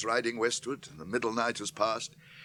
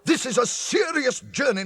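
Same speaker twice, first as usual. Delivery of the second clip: angry rebuke; 10 semitones higher, 5 dB louder than usual. Acted. Gandalf scolding Pippin for the stone, furious. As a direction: bite each word out in fury.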